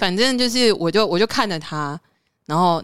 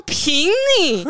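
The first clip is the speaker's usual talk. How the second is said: mocking scorn; 8 semitones higher, much slower than usual. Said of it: "我好气哦".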